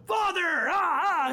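angels parody his shouting; mock shouted prayer. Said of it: "the angels going".

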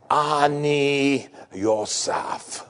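very slow, drawn-out Hebrew words; Joseph revealing himself. Acted. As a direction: let each syllable land, slowly. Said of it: "First time they heard Hebrew words".

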